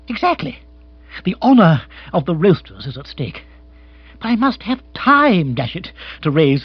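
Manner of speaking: high, loud protest; flustered aristocrat pleading his case; agitated lord, blustering. Acted.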